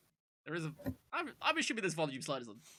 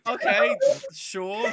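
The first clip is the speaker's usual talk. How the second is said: sarcastic; 6 semitones higher, 8 dB louder, much slower than usual. Real, not acted. Reluctant agreement.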